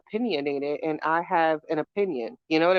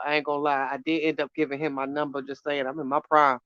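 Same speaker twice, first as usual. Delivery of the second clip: usual speaking voice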